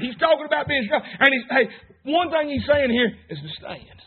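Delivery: usual speaking voice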